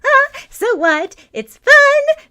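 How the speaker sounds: very high, loud gleeful chirp; a giddy girl shrugging off climbing in the window; starry-eyed glee. A narrator's reading. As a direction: bubble over with delight. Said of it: "starry-eyed".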